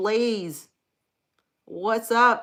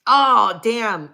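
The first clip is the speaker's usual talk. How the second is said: surprised; 4 semitones higher, 11 dB louder than usual. Real, not acted. Surprised reading a chat message.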